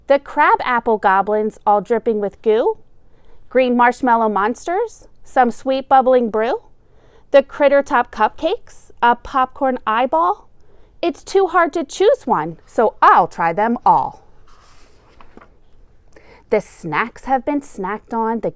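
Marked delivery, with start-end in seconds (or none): none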